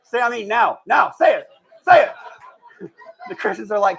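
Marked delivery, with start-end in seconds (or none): 0.0-2.1 loud, higher, demanding shout; blunt shout. Retold or imitated. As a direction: bark the order at the crowd. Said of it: "It's very blunt".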